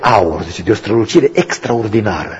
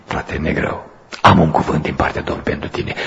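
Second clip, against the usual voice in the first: hushed; 6 semitones lower, 4 dB quieter than usual. Retold or imitated. Quiet reply at the sickbed.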